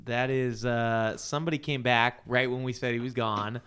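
ordinary talk, nothing marked

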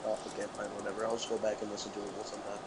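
sulky mutter. Pretend. Sulking after intro refused.

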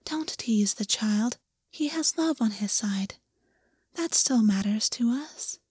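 whispered, slow gentle rebuke; soft chiding whisper. Acted. Rabbit chides the bird, soft whisper. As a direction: hush the bird kindly. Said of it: "in a voice so soft".

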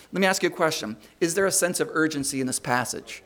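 ordinary talk

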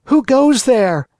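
loud, slow, high call into darkness; shouting into the dark. A narrator's reading. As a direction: call out fearfully into the night. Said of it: "Once or twice he shouted".

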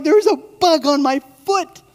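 put-on high, loud, panicked child voice. Preacher imitating his petrified daughter, high and panicked. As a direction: mimic a terrified little girl. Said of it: "But she's petrified".

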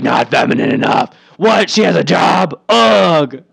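loud scoffing voice; mock disdain. Mocking critics of Hillary, loud and scoffing.

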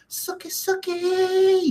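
high, slow put-on squeaky taunt; cartoonish high-pitched teasing voice; mock taunt. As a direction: sing it out like a cartoon tease. Mocking taunt on stream.

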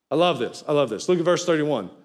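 normal talking voice

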